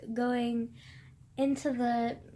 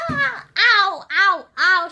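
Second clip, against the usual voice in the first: loud, high yelps of pain; 6 semitones higher, 13 dB louder, slower than usual. Real, not acted. Hurting her ankle mid-review, yelping loudly. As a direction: cry out at a sudden sharp hurt. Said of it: "Fuck, that hurt".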